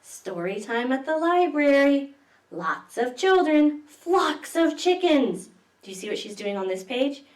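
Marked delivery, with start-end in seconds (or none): 0.0-5.5 higher, louder, slow read-aloud; storybook announcing. A narrator's reading. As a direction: announce it to the children. Announces story time page.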